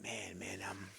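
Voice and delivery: whispered under his breath, scared. Retold or imitated. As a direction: breathe it out in fear. Scared, muttering to himself.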